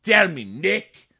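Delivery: loud, high, drawn-out mock demand. Bossy sister ending her grilling of Nick, mock demand.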